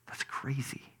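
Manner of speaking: very soft, whispered aside; hushed amazement. Real, not acted. Preacher marveling at believing before any proof, hushed. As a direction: whisper it in wonder.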